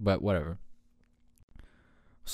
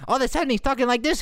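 high, rapid-fire mock accent; Tony Soprano; 13 semitones higher, 4 dB louder, faster than usual. Host showing the mob boss's sudden switch, mock impression. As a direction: rush it in a silly high voice.

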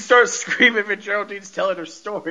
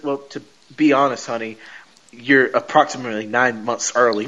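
deep Vision voice; 8 semitones lower than usual. Retold or imitated. Vision voiced in retold scene.

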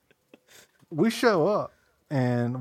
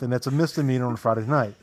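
usual speaking voice

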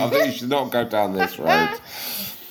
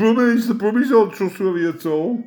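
higher Brummie voice; Birmingham accent; 10 semitones higher, 4 dB louder than usual. Retold or imitated. Brummies' verdict on themselves in a Birmingham accent. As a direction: sing it like a Brummie.

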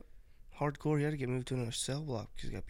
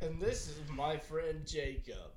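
slow, put-on presenter voice, softer; cringy introduction; 3 semitones higher, 5 dB quieter, much slower than usual. Friend introducing his buddy to the stream, cringily. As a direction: draw out a hammed-up introduction. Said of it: "That is so cringy".

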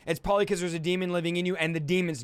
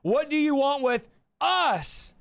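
lightly imitated high, drawn-out cry; mock cry, stretched on the last word; Pagani; 5 semitones higher, slower than usual. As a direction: imitate a preacher's slow, raised outcry. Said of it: "As Pagani would say".

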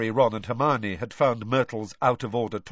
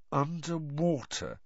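slow; 5 semitones higher, 4 dB quieter, slower than usual. Acted. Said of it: "Harry said slowly".

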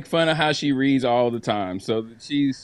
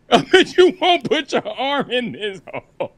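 mocking dare through laughter; laughing taunt; 7 semitones higher than usual. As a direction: dare him while laughing. Taunt repeated, cracking up.

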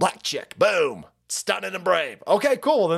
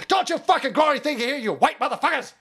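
high, hammed-up yelling at settlers; mock furious high yell; mock angry shout; 9 semitones higher than usual. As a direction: play a ranting mob. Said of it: "And they said".

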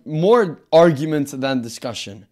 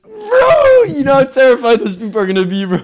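excited shouting; 8 semitones higher, 5 dB louder than usual. Real, not acted. Excited tank fantasy.

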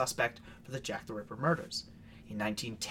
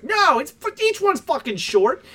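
mock yell; 11 semitones higher, 6 dB louder, slower than usual. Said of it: "And I was like".

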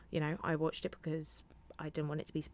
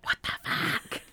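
stunned whisper; 3 dB louder, slower than usual. Retold or imitated. Stunned reaction to show.

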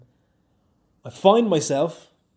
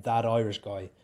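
ordinary talk, nothing marked

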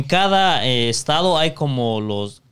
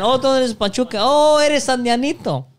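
mocking taunt; 8 semitones higher than usual. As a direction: tease them in a sing-song voice. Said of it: "Y le decían".

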